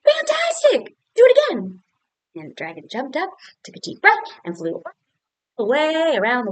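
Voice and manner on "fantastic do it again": high excited shout. Acted. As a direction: cheer the dragon on like a fan. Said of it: "And Elizabeth said".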